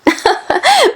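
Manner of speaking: high-pitched amused laugh; tittering grandmother; high giggle. Acted.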